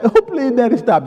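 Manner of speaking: high-pitched playful begging; pleading child. Retold or imitated. Tickled kid begging daddy, high pleading. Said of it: "No, they didn't do that".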